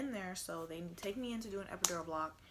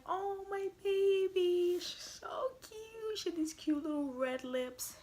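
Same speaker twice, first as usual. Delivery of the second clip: much higher, slow, fading to a whisper; tender coo; 11 semitones higher, 6 dB louder, slower than usual. Retold or imitated. Mom cooing over her newborn baby, tender.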